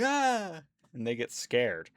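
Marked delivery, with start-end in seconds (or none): 0.0-0.6 mock kid scream. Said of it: "the kids are like".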